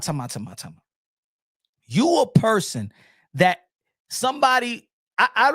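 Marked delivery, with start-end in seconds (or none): 0.0-0.8 dropped low and soft. Real, not acted.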